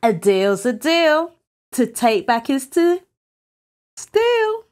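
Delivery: high sing-song taunt with a held-back last word; rising, teasing playground chant; taunting rhyme. Acted. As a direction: chant it smugly, pausing before the end. Trickster quotes the rhyme back.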